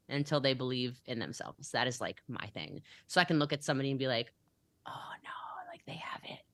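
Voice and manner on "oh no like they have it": whispered, marveling aside; hushed inner thought. Acted. Leader privately spotting someone's potential, hushed. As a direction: voice a private thought under your breath.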